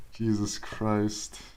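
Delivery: slow disbelieving sigh of a curse; slow exasperated groan. Real, not acted. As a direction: drag out a despairing Jesus Christ, very slow. Exasperated at chat message.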